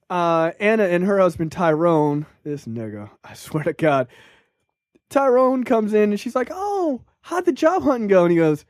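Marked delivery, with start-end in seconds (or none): 6.5-8.2 a higher, light sweet-lady voice for the wife. Retold or imitated. Podcaster mimics the sweet wife asking about the job hunt, lightly voiced. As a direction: play the gentle wife asking her husband. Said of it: "a sweet lady".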